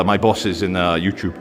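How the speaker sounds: ordinary talk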